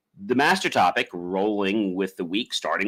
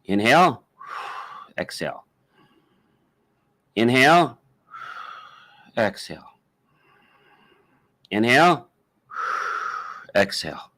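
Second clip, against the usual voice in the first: pace out the breaths, letting each exhale drop away; guided breathing demo. Shown as a demonstration. Coach leading listeners through a breathing technique, guided breathing demo. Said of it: "doing a breathing technique like this".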